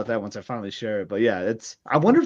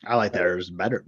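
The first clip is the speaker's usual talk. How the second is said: low, lightly growled pirate voice; 7 semitones lower, 4 dB quieter than usual. Acted. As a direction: say it like a swaggering pirate, lower. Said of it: "I'm still talking in that pirate voice".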